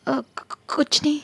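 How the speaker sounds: soft, faltering stammer; rattled and hesitant, barely getting it out; flustered, nervous. Acted. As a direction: stumble nervously over a quiet denial. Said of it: "तुम इतनी घबराई हुई क्यों लग रही हो?".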